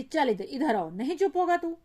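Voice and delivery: high, loud scolding yell; angry shout. Acted. Mother yelling at the crying child she pulls away, loudly. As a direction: shout him down as you drag him off.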